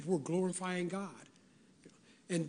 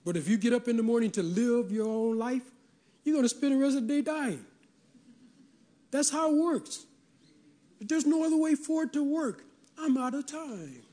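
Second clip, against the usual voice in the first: higher, faster preaching build that climbs to the peak; fervent preaching; 5 semitones higher than usual. Acted. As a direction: drive the point home like a pulpit climax. Sermon climax, rising fervor.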